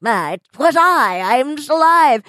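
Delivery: mock dramatic gloat; hammed-up villain reveal. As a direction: drag out the reveal like a melodrama villain.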